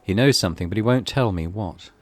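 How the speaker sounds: ordinary talk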